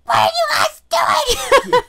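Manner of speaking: Ma Kettle voice. Retold or imitated. Mother finding her kids in the trailer park, Ma Kettle shout. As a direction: yell it like a frazzled farm mom. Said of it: "Were you using your Ma Kettle voice".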